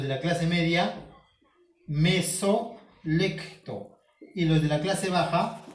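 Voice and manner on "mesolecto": drawn out term. Shown as a demonstration. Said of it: "teacher stretching out a new term".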